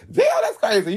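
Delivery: breathless laughing damn; laughing gasp. Real, not acted. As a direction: laugh it out high and airy. Laughing at own words.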